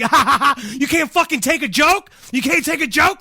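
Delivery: loud, high mock laughing taunt; imitated Gacy. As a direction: act out a manic bully jeering at his victim.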